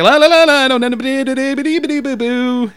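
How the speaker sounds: high la-las sliding into boo-boos; evasive nonsense babble. Retold or imitated. Escaping coworker at the station, nonsense humming. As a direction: babble busily to dodge the talk.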